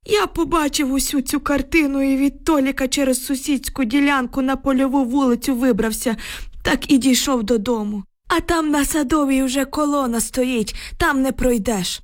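put-on high-pitched old man's voice, upset; agitated old village man. A narrator's reading. Shaken neighbour reports to the women, agitated old man.

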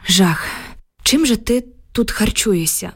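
understated exclamation of dismay, narrated; mild exclamation. A narrator's reading. Narrator exclaiming to Oksana about what she eats, mildly exclaimed. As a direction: exclaim it mildly, without really shouting. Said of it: "вигукнула я".

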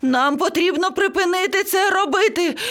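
high-pitched panicked parrot; screeching. A narrator's reading. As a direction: plead in rising panic, very high. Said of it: "зарепетував папуга". (панічний високий крик)

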